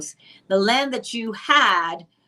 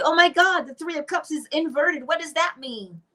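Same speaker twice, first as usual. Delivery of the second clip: mock panic; 7 semitones higher than usual. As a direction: gasp over the card like it is a disaster. Mocking a panicky card reader.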